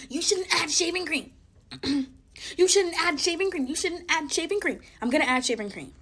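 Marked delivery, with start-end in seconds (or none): 0.1-1.3 high-pitched mimicry of nagging commenters; mock scolding, pitched well up. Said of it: "for the people who are saying". 2.6-4.8 high, loud parroting of critics; singled-out critics, mimicked high; mocking commenters. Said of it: "for the people who are saying".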